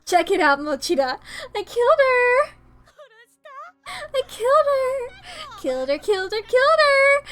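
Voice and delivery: high, crazed shrieking; manic gloating. Acted. Crazed girl crows that she killed her rival, manic shriek. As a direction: revel in it like a madwoman.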